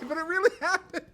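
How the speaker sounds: high, loud laughing speech; laughing real. Real, not acted. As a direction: say it while cracking up. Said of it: "laughing through the line".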